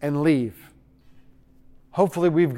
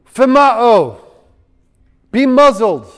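loud, raised, authoritative order; forceful command; 4 semitones higher, 6 dB louder, slower than usual. Acted. Jesus commanding the raging storm, forceful. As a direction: silence it with authority.

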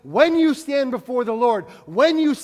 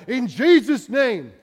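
mock magic spell; 6 dB louder, slower than usual. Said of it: "Like I'm casting a magic spell".

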